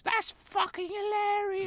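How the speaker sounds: very high, slow Essex English squeal; mock Essex accent. Essex local delighted by the American, squealing in a mock English accent. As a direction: shriek it in an exaggerated Essex accent. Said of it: "everybody talk like this".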